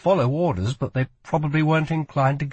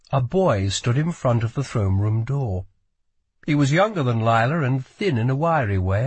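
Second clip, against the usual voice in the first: plain reading voice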